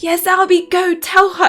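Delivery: high, loud urging on; cheering him on from the page; eager cheering. Retold or imitated. Reader urging Albie on, high excited cheer. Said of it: "and you're like".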